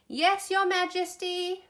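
high, slow sing-out reply; drawn-out, high-pitched eager answer; bellowed chorus. A narrator's reading. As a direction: call it out like a group of children. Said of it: "bellowed the children".

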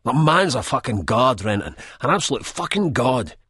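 coked-up rant. A narrator's reading. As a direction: rant about the footballer, wired on cocaine. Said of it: "he rants".

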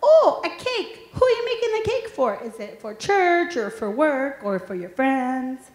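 imitating her little son asking about the cake; toddler son. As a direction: play a two-year-old asking sweetly. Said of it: "he came up and he said".

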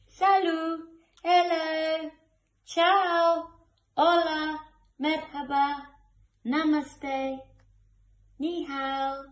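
bright, high, loud string of hellos; cheerful greetings. Acted. Reading to children, calling out hellos in many languages. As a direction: sing out each hello with delight. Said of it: "so many different ways to say hello".

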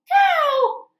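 high loud reveal of the cow; excited call. Acted. Reading to kids, loud high reveal of the cow. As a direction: shout it up high.

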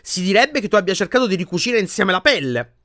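high, mock-amused accusation; a touch of joyless amusement; mirthless sarcasm. A narrator's reading. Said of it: "con una risata priva di allegria".

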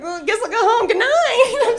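put-on high, loud drunken goodbye; hammed-up tipsy voice; acting drunk. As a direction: play a drunk calling good night. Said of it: "I'm so drunk".

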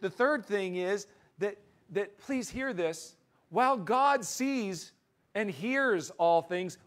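loud, high, emphatic pulpit voice; fervent preaching. Acted. Pastor preaching hard to his congregation, loud and urgent. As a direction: drive the point home to the room.

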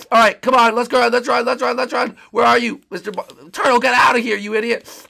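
high, hurried calls to flee; urgent shouting. Acted. Protector getting the turtle out, urgent and high. Urging escape during fight.

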